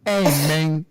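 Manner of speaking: low, drawled mock Cuban-tough-guy accent; Razor Ramon impression. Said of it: "he used to be like".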